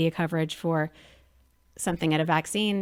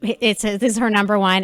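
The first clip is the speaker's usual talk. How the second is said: loud, bubbly rush into a funny story; animated mom launching a funny story; excited storytelling; 7 dB louder than usual. Real, not acted. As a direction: tell it like you can't wait to share it.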